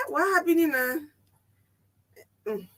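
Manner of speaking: ordinary delivery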